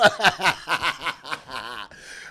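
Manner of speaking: bursting out laughing; loud laugh. Real, not acted. Comic reacting to the Griner news, loud laugh. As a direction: break up laughing.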